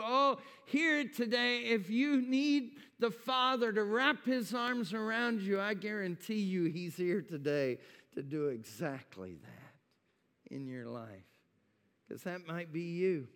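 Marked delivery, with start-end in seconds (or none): 0.0-11.4 higher, louder preacher's appeal; preaching. Acted. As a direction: press it home to the congregation. Preaching at full force.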